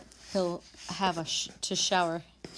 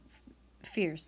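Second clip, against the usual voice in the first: ordinary delivery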